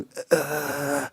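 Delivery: a short strained groan of lament; groaning sigh. Shown as a demonstration. Preacher demonstrating a wordless groan of prayer, strained sigh. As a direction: let out a wordless sigh of grief. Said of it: "가끔 이렇게 탄식하는".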